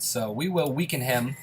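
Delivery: ordinary delivery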